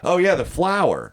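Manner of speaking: high-pitched "oh, of course"; retold breezy recognition. Imitating Kenny explaining baby's breath, high and matter-of-fact. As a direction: lightly imitate a friend who gets it at once.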